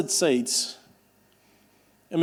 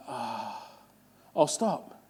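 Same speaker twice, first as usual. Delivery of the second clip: deflated give-up sigh; 6 semitones lower, 10 dB quieter, much slower than usual. Retold or imitated. Imitating giving up.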